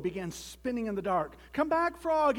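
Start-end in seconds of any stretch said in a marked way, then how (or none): 1.5-2.4 high, loud desperate call; pleading toad yelling out; shouted plea. A narrator's reading. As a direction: shout after a vanished friend. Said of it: "he shouted".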